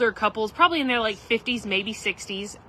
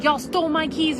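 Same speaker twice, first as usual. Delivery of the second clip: mock accusing; 6 semitones higher than usual. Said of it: "she was really pissed".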